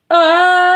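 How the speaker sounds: high, loud sudden shriek; outraged shriek. Real, not acted. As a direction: blurt out a shocked cry. Outraged outburst.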